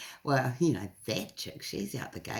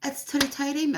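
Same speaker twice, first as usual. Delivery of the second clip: much higher, eager hello; retelling her own chirpy phone hello, pitched up; bright phone greeting; 12 semitones higher than usual. Retold or imitated. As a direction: announce yourself like an old friend calling. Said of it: "I'm like".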